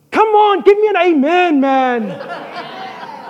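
rally the room for an amen; calling out. Real, not acted. Preacher calls congregation for response.

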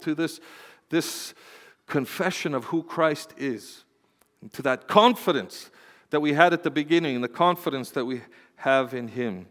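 normal talking voice

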